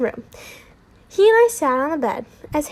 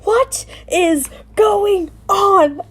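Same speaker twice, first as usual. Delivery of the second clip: high, loud, slow, word by word; exasperated yell; 7 semitones higher, 5 dB louder, much slower than usual. A narrator's reading. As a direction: stretch out each word in a raised voice. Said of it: "I yelled".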